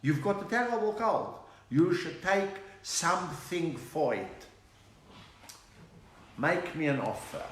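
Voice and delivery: lightly voiced Yiddish reply; a hint of Yiddish accent.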